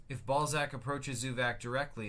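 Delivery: ordinary delivery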